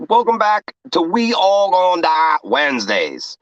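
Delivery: bright, high hype opener; show host intro. Acted. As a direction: greet the stream like a host. Stream opener greeting.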